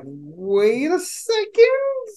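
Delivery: slow, high, drawn-out double take; surprised. Retold or imitated. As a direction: stretch it out, caught off guard. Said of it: "I'm like".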